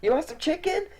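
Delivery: put-on very high, coaxing child voice; little girl offering. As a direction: coax the cat sweetly. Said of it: "and then is like".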